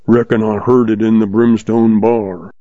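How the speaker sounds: plain reading voice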